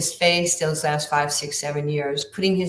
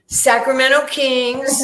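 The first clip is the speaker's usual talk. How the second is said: the repeat is even louder, high and drawn out; proud repeated announcement; 8 semitones higher, 7 dB louder, slower than usual. Retold or imitated. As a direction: hammer home "Sacramento Kings" a second time. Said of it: "And I went".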